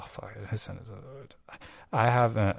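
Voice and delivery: normal talking voice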